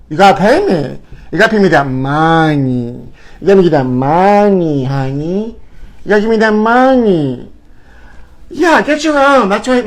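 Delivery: diva insisting on cash; hammed-up money demand; sassy demand. Vlogger demanding payment from Labubu borrowers, sassy.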